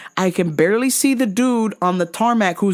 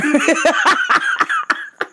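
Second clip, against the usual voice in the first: rising burst of real laughter; high cackle; 10 semitones higher than usual. Real, not acted. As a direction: crack up at the word. Laughs after Haboob.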